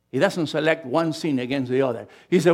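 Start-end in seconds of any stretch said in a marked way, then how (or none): none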